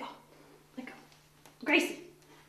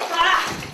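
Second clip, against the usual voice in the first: loud high yelps; sharp pained yelps; 5 semitones higher, 9 dB louder than usual. Real, not acted. Owner yelps while the cat fights the bath, loud. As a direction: yelp as the claws hit.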